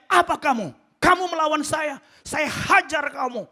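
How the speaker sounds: loud, high threatening shout; angry father shouting. Retold or imitated. Father threatening his defiant son, acted furious shout. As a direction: roar like an enraged dad. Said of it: "Langsung teriak".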